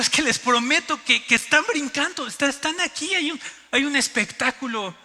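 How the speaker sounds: high, emphatic pleading; insistent excitement. Retold or imitated. Tourist promising foreigners the whales are there, insistent and excited. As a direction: insist eagerly to be believed.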